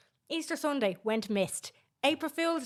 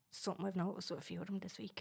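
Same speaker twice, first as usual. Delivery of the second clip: whispering; 3 semitones lower, 10 dB quieter, faster than usual. Acted. Joint whispering bit.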